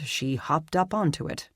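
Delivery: plain reading voice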